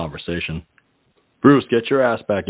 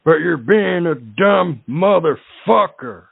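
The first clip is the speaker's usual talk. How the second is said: higher, slow ranting radio host voice; mock a fed-up radio host scolding a caller; hammed-up angry host, drawn out; radio host impression; 9 semitones higher, slower than usual. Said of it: "in the words of a great radio show host".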